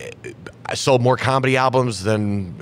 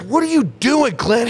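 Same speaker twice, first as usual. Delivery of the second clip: much higher, louder squeal of protest; outraged Jeff; 12 semitones higher, 3 dB louder than usual. Retold or imitated. Jeff yelling at drunk Glenn on the plane, high squealing outrage. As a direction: shriek at your seatmate in disbelief. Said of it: "He's like".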